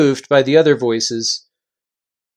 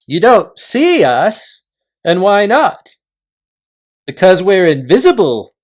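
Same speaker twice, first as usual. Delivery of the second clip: loud, high chieftain calling a halt; gruff invisible chief; 4 semitones higher, 6 dB louder than usual. Acted. Invisible chief halting the Narnians, loud and high. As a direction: bark the warning from thin air.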